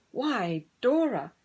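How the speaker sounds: a hint of a duck voice; lightly quacking duck. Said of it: "quacked Duffy".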